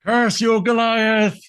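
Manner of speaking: high, drawn-out melodramatic cry; hammed-up villain curse. Villain curses Goliath, melodramatic wail. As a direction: hurl a theatrical curse, pitched high. Acting villain cursing the hero.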